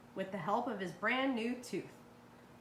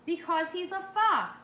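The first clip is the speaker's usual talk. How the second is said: higher and louder, emphatic; stern dentist; 8 semitones higher, 6 dB louder than usual. A narrator's reading. Doctor DeSoto warns his wife, loud and emphatic. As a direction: insist hard, he's dangerous. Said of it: "said Doctor DeSoto".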